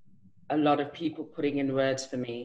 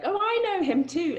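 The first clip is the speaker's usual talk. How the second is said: cheeky. Retold or imitated. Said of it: "I was like".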